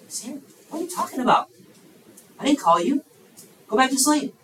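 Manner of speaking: high-pitched, baffled protest; exasperated priest. Acted. Priest woken twice sends boy to bed, exasperated.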